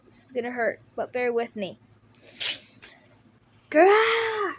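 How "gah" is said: loud high cry of pain; sudden pained shout; agonized cry. Acted. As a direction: yell through the pain. Painful move to save everyone.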